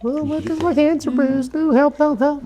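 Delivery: very high, hammed-up helpless cry for help; mock damsel in distress. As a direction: play the fragile little thing begging for rescue. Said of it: "And you're like".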